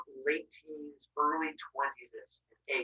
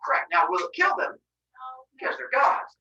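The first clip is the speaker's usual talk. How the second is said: normal talking voice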